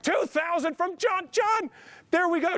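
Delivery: very high, slow, triumphant call of the bid; elated shout. Real, not acted. Long-awaited bid finally arrives.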